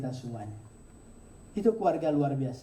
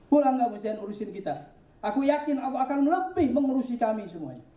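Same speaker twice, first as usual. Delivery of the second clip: resolute wife; 8 semitones higher than usual. Acted. Hajar sends Ibrahim home.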